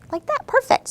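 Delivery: very high and loud praise; delighted teacher praise; high excited praise. Real, not acted. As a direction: celebrate the try. Praising a child.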